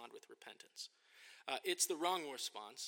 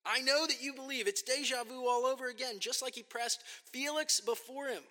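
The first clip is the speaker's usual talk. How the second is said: emphatic; 10 semitones higher, 9 dB louder than usual. Real, not acted. Preacher presses the point emphatically.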